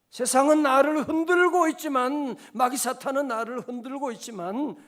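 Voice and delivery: loud, high preaching cry; preaching at full force. Real, not acted. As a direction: proclaim it over the room. Preaching climax.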